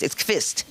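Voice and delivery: Kvist with kv at the start; corrected pronunciation. Retold or imitated. Comic insists on the name, Kvist said with kv. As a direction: say "Kvist" with a v, no w glide. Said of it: "It's not Quist".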